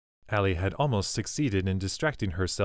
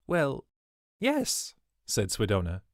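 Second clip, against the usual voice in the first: much higher, caught out; a flustered servant scrambling to answer; flustered high yes; 7 semitones higher than usual. A narrator's reading. Swidona flustered.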